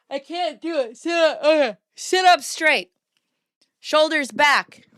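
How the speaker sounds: high, loud posture commands; self-coaching orders. Pretend. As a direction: order yourself upright, pitched high. Coaching own posture aloud.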